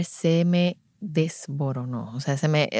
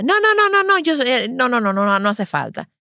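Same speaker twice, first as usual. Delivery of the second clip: very high rapid string of no's; flat refusal; 6 semitones higher than usual. Retold or imitated. Mother refuses to nurse in front of the consultant, high and insistent. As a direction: shut it down hard, pitch jumping up.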